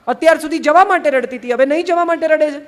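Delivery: high, loud baffled protest; exasperated. Acted. Kabir confronts Lali's changed reason for crying, exasperated. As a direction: raise your voice in exasperated disbelief.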